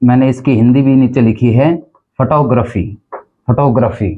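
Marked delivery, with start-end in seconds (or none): 2.2-4.1 फोटोग्राफी as fuh-TOG-ruh-fee; फोटोग्राफी repeated with weak "fuh" and "gruh"; correct pronunciation. Shown as a demonstration. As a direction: model फोटोग्राफी with a short "fuh". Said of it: "इसका जो उच्चारण है".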